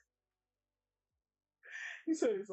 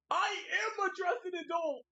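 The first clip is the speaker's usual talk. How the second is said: indignant shout; 11 semitones higher than usual. Real, not acted. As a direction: blurt it out, offended. Indignant reply to chat joke.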